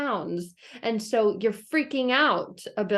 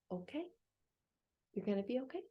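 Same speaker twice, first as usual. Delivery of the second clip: much softer placating murmur; humoring reassurance; 15 dB quieter, much faster than usual. Retold or imitated. Animals placating Chicken Little, soft humoring reassurance. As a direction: humor her gently. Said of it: "And they're like".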